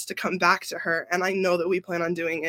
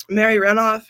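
normal talking voice

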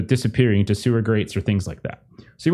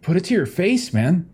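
a hint of a higher, hesitant stranger; scrawny guy voice; 6 semitones higher than usual. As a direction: offer help awkwardly. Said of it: "He's like".

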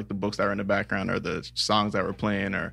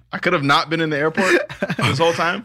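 louder, higher, half-laughing protest; laughing indignation; 7 semitones higher, 5 dB louder than usual. Real, not acted. Passenger learning the airport wait was needless, laughing indignation. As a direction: protest in amused disbelief, pitched up.

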